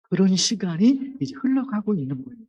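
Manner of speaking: ordinary talk, nothing marked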